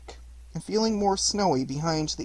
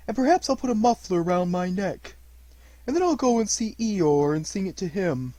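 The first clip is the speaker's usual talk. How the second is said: a hint of a bumbling, cheerful bear voice; lightly voiced bear; 5 semitones higher, 5 dB louder than usual. A narrator's reading. Pooh plans to sing to Eeyore, light bear voice. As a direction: speak as a simple contented bear. Pooh's voice in story.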